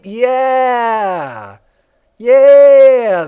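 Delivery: drawn-out loud yeah; big excited shout of yes; excited yell. Real, not acted.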